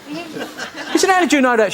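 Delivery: can't get the punchline out for laughing; real laughter bursting through the punchline. Real, not acted. Laughing through his own punchline.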